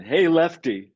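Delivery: Brooklyn Italian greeting; New York Italian "Hey, Lefty"; Brooklyn Italian accent. Retold or imitated. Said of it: "In the Brooklyn Italian accent".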